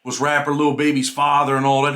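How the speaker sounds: usual speaking voice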